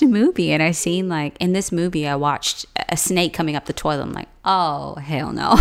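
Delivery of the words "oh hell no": slow, laughing "Oh, hell no."; laughing refusal. Retold or imitated. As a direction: drag it out through a laugh. Reacting to snake in toilet.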